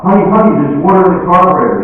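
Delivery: high, loud, urgent announcement; alarmed wife. Acted. Wife running into the house to tell her husband, alarmed.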